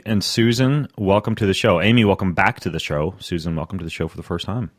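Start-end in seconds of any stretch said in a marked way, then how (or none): none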